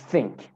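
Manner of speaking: "think" with tongue between the teeth; proper TH. Shown as a demonstration. Teacher shows students the TH sound starting "think". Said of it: "T H sesini çıkarmak için".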